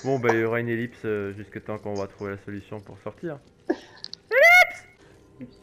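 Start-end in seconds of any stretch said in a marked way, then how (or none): 3.7-5.5 booming Skyrim-style shout, very high; dragon shout. Pretend. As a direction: bellow the word like a dragon shout. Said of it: "Et là, tu recris ellipse".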